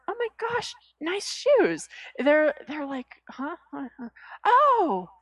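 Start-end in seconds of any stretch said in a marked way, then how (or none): 0.1-1.9 high, bright mock-gush; gushing compliment. Stranger complimenting a passerby's shoes, high excited gush. 3.3-5.1 high, confused huhs into a loud thankful oh; startled then grateful. Pretend. Passerby startled by a sudden compliment, surprised thanks.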